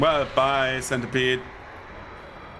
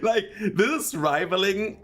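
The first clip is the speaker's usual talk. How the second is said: high, giggly, slowed with laughter; laughing with delight; 7 semitones higher, slower than usual. Real, not acted. Gamer laughing at huge weapon damage, delighted. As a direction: laugh through the praise.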